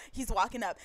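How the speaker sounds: ordinary delivery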